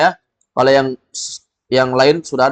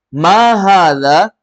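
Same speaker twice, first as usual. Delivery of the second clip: louder, very slow model question; teacher leads the drill; 5 semitones higher, 5 dB louder, much slower than usual. Shown as a demonstration. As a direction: call it out slowly to the class. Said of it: "drill prompt".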